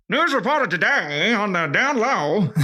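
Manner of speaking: put-on old-timey radio host. Mocking how people back then talked, slow old-time radio host. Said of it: "just sounded like that old-timey fifties radio host, like".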